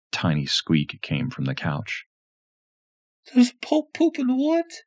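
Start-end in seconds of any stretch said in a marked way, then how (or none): 3.3-4.8 put-on very high, teasing child; cheeky little girl; sick little girl voice. A narrator's reading.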